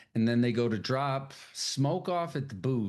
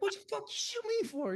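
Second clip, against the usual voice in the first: outraged friend; 4 dB quieter, much faster than usual. Retold or imitated. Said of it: "And he's like".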